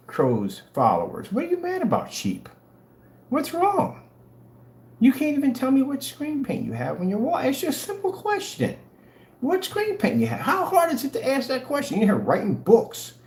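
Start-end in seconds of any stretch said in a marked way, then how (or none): 1.3-12.0 high, taunting mock whine. Streamer taunting arguing followers in a high mock whine. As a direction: taunt them in a squeaky high voice.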